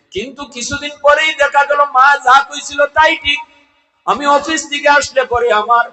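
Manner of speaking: high, loud sermon chant; full-force preaching. A narrator's reading. Preacher telling of a wife turning son against mother, high impassioned chant. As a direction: raise the story into ringing, high-pitched preaching.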